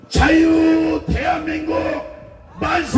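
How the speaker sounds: slow, stretched rally cry of long live; shouted cheer. Real, not acted. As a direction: lead the crowd in a loud cheer. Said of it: "구호를 만세 삼창을 외쳐주시기 바랍니다".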